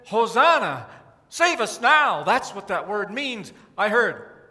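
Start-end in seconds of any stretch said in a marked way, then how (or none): 0.1-2.2 called out louder; retold cry from last night; louder crowd cry. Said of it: "when I said".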